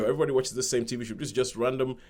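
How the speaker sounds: ordinary delivery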